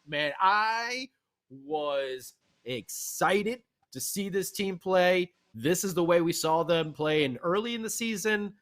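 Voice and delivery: ordinary talk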